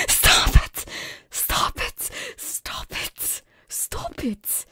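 loud, helpless giggly plea; laughter bursting through, then easing; laughing protest. Real, not acted. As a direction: beg them to stop while cracking up. Tells self or chat to stop laughing.

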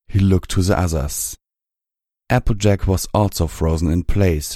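ordinary delivery, nothing marked

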